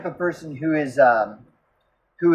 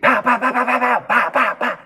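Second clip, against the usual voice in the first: higher, rapid mock gunshots; gunshot imitation; 8 semitones higher than usual. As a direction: spit out each pow like a shot. Mimicking gunshots a second time.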